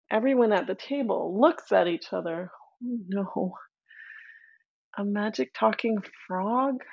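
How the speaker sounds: ordinary delivery, nothing marked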